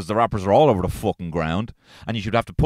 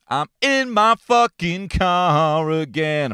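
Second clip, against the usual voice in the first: higher and slow, stretching every word in mock despair; exasperated drawn-out repeat; 7 semitones higher, much slower than usual. Shown as a demonstration. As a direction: drag it out like a weary lament. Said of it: "repeats the line performed".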